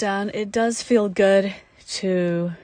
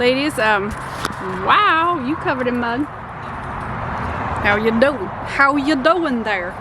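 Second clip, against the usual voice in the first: mock Jersey accent, a touch playful; lightly put-on Jersey tough-guy greeting; Jersey construction worker; 3 semitones higher than usual. Greeting the kids in a mock Jersey accent. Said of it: "I'm a Jersey construction worker".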